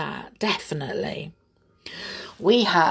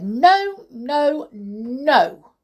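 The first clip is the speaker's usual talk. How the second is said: loud, high, separate refusals; emphatic refusal; 7 semitones higher, 6 dB louder, much slower than usual. A narrator's reading. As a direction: refuse firmly, each word its own outburst. Bear boasts of his refusal.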